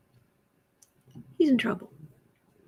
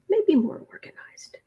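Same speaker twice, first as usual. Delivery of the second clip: hushed, breathy repeat of the remark; whispered aside; 6 semitones higher than usual. Real, not acted. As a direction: drop to a conspiratorial whisper.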